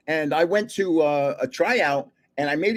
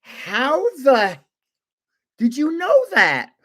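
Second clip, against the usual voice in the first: much higher, louder amazed wife; Susie astonished; 9 semitones higher, 4 dB louder, slower than usual. Retold or imitated. Wife turning to her trivia-nut husband, astonished and high. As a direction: play the stunned wife, pitched way up. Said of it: "Susie looks at me and goes".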